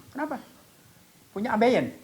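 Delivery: high-pitched puzzled question; bingung bertanya. Retold or imitated. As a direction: imitate a baffled doctor. Menirukan dokter yang bingung bertanya. (tiruan dokter bingung bersuara tinggi)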